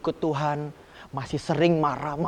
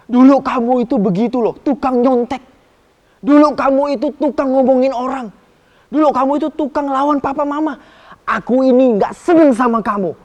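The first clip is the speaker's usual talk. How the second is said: louder, higher scolding; mock nagging; 8 semitones higher, 10 dB louder than usual. A pretend grudging god listing a child's sins, mock scolding. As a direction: rub old faults in, accusing.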